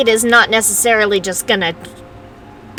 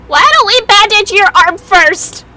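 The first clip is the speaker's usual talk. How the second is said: worried high plea; 11 semitones higher, 6 dB louder than usual. Real, not acted. Worried about bleeding princess.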